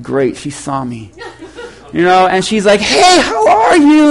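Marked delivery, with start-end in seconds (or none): 0.0-1.1 low, slow, hushed dread; dismayed aside. Retold or imitated. Pastor spotted by woman he avoids, dismayed. 2.8-4.1 high, drawn-out chirpy impression; over-happy greeting. Annoying church woman greets the pastor, mock-happy. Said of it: "she's all happy".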